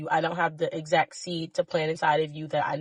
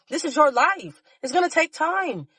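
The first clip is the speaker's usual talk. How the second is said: raised, fervent plea, voice pitched higher; impassioned higher-pitched urging; passionate; 6 semitones higher than usual. Real, not acted. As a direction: pour your whole heart into it, pushing upward. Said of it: "I'm sorry that I'm so passionate".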